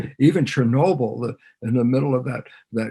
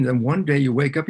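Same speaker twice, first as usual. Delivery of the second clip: usual speaking voice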